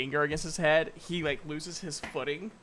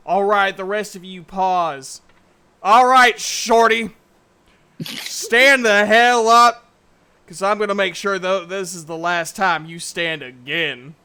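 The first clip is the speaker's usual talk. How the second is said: loud, higher, menacing shout; angry threat; 4 semitones higher, 15 dB louder than usual. Acted. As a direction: bellow the threat at the little man. GM voices Duke threatening halfling.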